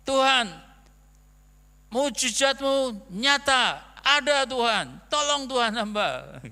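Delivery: very loud, high, pleading cry to God; fervent shouted prayer. Retold or imitated. Still thirsty, praying again on the train: loud pleading cry. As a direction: call out to the Lord with everything you have. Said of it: "Saya doa lagi".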